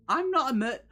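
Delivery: high, loud mock indignation; indignant outburst. Player denying the murder hobo charge, mock indignant. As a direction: cut yourself off in a huff.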